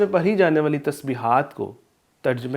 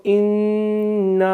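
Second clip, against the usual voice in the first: long drawn-out انا with nasal ghunna on the doubled n; Quranic recitation; 6 semitones higher, 3 dB louder, much slower than usual. Shown as a demonstration. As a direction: chant انا slowly, stretching the madd and humming the nasal. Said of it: "اس طریقے سے ہم پڑھیں گے".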